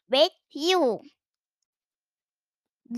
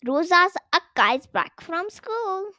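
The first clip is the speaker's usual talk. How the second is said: usual speaking voice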